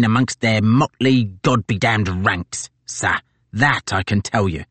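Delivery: a gloating sergeant pleased with himself; brash marine sergeant. Acted. Tozer to his captain, brash and gloating.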